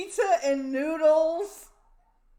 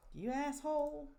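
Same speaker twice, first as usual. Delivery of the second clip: ordinary talk, nothing marked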